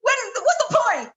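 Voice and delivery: high, squealing exasperated complaint; worn-out protest. Retold or imitated. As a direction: act out being fed up, voice climbing very high. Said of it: "once I had got tired".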